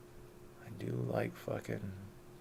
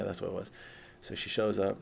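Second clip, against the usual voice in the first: ordinary talk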